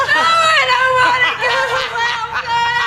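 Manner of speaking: high, loud protesting shout; loud refusal. Acted. Refusing welfare loudly.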